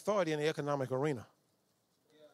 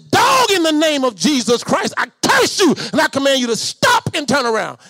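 commanding shout; 7 semitones higher, 12 dB louder than usual. Retold or imitated. Preacher facing a charging dog, commanding shout. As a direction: rebuke the dog like a preacher casting out a demon.

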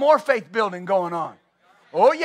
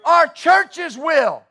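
fiery shouted preaching; 5 semitones higher, 10 dB louder than usual. Acted. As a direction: preach it full force to the crowd. Preaching at full force.

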